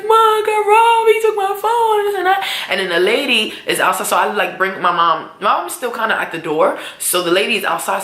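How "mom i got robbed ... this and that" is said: crying to mom. Retold or imitated. Crying to her mother.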